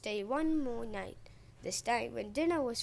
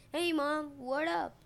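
put-on higher, louder kid-bruiser holler; mock shout; 5 semitones higher, 4 dB louder, slower than usual. A narrator's reading. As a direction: call out like a bulky toddler dude. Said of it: "shouting in a deep voice".

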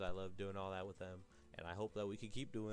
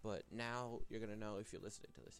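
ordinary talk

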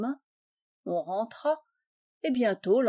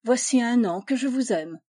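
louder, bursting out with it; exclaiming; 3 semitones higher, 6 dB louder than usual. A narrator's reading. Suitor suddenly declares his love, louder exclamation. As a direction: cry out the confession. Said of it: "je m'écriai".

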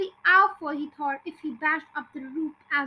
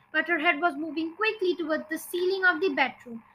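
plain reading voice

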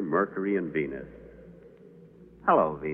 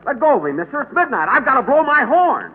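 frantic; 12 semitones higher, 6 dB louder than usual. Acted. Angel struggles with man.

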